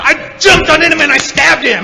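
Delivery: put-on a drunk uncle shouts his hunting brag; proud shout. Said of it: "He said".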